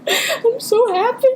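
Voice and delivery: very high, slow, squeaky-laughing words; helpless real giggles through the words; giddy giggling. Real, not acted. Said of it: "Stop giggling!".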